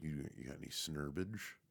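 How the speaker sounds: low, quiet retold question; imitating mom's sly offer. Mom quietly offering her kid weed, low and hushed.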